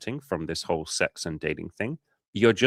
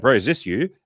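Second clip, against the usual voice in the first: teasing bro; 5 semitones higher, 7 dB louder than usual. Retold or imitated. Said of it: "And I'd be like".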